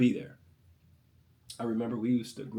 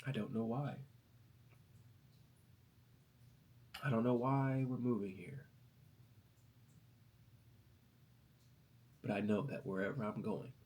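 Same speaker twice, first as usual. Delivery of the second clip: soft, tearful quote of mom, trailing down; a son retelling his tearful mother's words; mom's tearful words; 10 dB quieter than usual. Retold or imitated. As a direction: quietly relive mom's tears. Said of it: "she had tears in her eyes, and she says".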